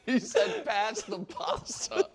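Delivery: slow out the punchline through laughter; high, real laughter breaking into the words; cracking up while repeating a silly mishearing; laughing hard. Real, not acted. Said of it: "You're laughing so hard".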